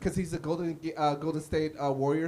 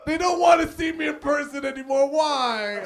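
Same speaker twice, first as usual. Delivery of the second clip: mock wail; 8 semitones higher, 4 dB louder than usual. Mock lament about therapy.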